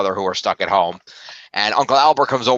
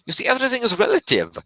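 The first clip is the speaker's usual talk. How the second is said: high-pitched cartoon German accent; 13 semitones higher than usual. Podcaster mimics the actor playing Albert Einstein, mock German accent. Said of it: "really stereotypical German accents".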